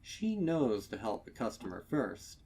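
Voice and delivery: ordinary delivery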